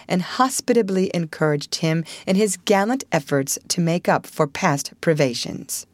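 plain reading voice

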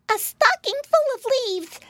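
put-on very high childlike pig voice; squeaky-high storybook pig; little piggy boy. A narrator's reading. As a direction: answer as an earnest little piglet. Piglet answers the mouse.

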